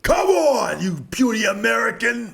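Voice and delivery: high, very loud mock Russian challenge; Russian movie fighter. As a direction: bellow a hammed-up Russian taunt. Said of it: "the Russian takes his jacket off".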